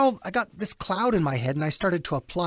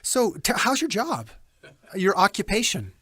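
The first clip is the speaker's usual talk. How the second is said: high, mechanical scripted questions; a hint of a cold caller; telemarketer voice; 4 semitones higher than usual. Shown as a demonstration. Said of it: "you sound like a telemarketer".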